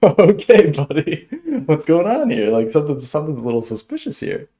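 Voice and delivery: wary and amused, pulled back at the weirdness; a startled customer eyeing the odd server with suspicion; surprised. Retold or imitated. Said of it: "I'm like".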